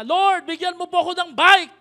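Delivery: put-on child calling out to God at full voice; loud child's prayer. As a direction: shout the prayer up high like an eager kid. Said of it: "nag-pray siya ng malakas". (batang sumisigaw ng dasal)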